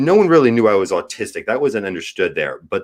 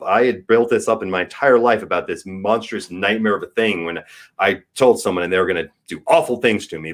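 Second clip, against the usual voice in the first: normal talking voice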